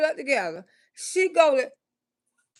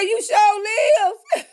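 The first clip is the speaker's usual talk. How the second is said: laughing shriek; 9 semitones higher, 3 dB louder, much slower than usual. Real, not acted. Reacting to his leaking confession.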